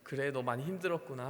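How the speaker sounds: quiet, consoling father's voice; tender comfort. Acted. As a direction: soothe a tired child. God comforting Moses.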